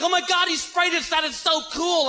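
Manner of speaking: high, giddy freaking out; excited Californians. Retold or imitated. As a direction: gush like thrilled tourists. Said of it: "the Californians are freaking".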